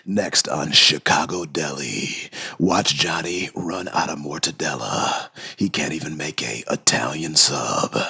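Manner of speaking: very low, slow announcer; hammed-up deep trailer voice teasing the next episode; TV promo voice. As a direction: intone it like a dramatic TV promo. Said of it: "I could do that with this voice".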